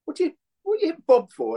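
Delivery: high, excited London voice, quick questions; high-pitched mock Ian Wright demanding answers; Ian Wright impression. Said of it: "and he's going".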